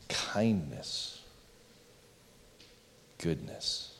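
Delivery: slow, hushed list with long pauses; very quiet, spaced-out reading for reflection. Real, not acted. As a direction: read each word low and soft, letting it sink in. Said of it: "I'm gonna read them slowly".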